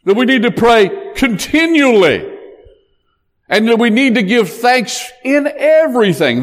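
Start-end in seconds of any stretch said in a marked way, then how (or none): 0.5-2.3 CONTINUALLY punched high; stress on CONTINUALLY. Shown as a demonstration. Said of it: "There's the emphasis". 4.2-6.4 IN EVERYTHING pitched high and punched; stress on EVERYTHING. Shown as a demonstration. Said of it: "There's the emphasis".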